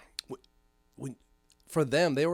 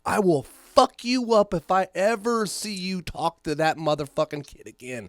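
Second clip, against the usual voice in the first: menacing threat; 4 semitones higher, 6 dB louder than usual. Retold or imitated. Threatening bullies he caught.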